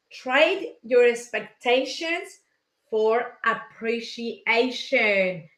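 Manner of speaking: slowly, word by word, so viewers can write it down; slow, deliberate quote. Shown as a demonstration. Quoting Tony Robbins to the viewers to copy down, slow and deliberate. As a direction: spell out the motto at a slow, careful pace.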